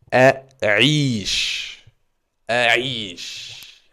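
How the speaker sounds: A'ish said slowly with a falling end; teacher sounds out A'ish; model pronunciation. Shown as a demonstration. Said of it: "le verbe a'ish". (prononciation modèle de a'ish)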